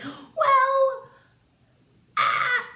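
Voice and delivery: high, loud hammed-up wailing cry; fake-sob it out like a wounded diva; mock wail. Scrooge hurt by the family's insult, hammed-up wail.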